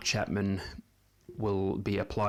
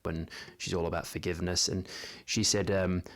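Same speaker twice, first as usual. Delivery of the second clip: normal talking voice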